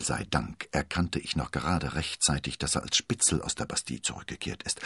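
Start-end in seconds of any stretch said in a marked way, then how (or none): none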